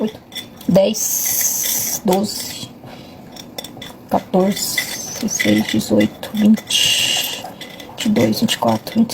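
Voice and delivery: counting through a hot mouthful; mouth full of hot food. Real, not acted. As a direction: talk around a bite of hot bread. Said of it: "Ai, tá quente". (boca cheia de comida quente)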